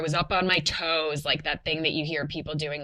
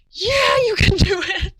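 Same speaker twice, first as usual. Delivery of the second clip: much higher, loud cheering; cheering hype team; 11 dB louder than usual. Retold or imitated. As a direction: cheer her on like a hype squad. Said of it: "the greatest hype team".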